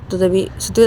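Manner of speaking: ordinary delivery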